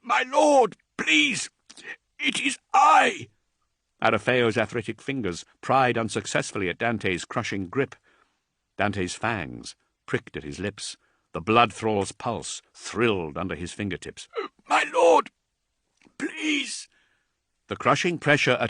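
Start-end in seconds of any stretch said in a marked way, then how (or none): 0.0-3.3 high, strained, loud choking plea; panicked plea. Acted. Thrall choked by Dante, pleading high and loud. Being choked by his lord. 14.7-16.9 high strained plea, fading at the end; desperate plea. Acted. Thrall pleading with his strangling lord, high and strained.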